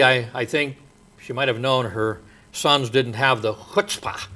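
ordinary talk

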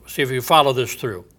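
ordinary talk, nothing marked

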